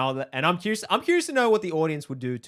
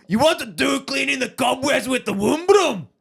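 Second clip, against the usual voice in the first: put-on loud, high, aggressive Scottish accent; 11 semitones higher, 5 dB louder than usual. Podcaster making co-host laugh with a dirty phrase, Scottish accent. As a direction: shout it slowly in a hammed-up Scottish accent. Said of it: "do a Scottish accent".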